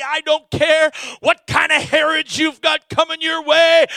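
loud, high, fired-up pulpit preaching; preaching at full force. Acted. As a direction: drive it home to the congregation.

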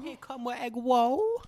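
high, loud, drawn-out mock accent; slow broken-English accent with rising end; mock foreign accent. Friend topping the meme line, mock foreign accent.

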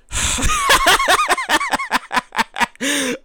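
real high-pitched laugh; giddy laugh. Real, not acted. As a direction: burst into a squeaky laugh. Laugh alone.

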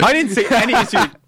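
loud, high-pitched protest; squealed, amused denial; high defensive denial. Real, not acted. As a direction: deny it all, voice shooting up. Denies co-host's teasing accusation.

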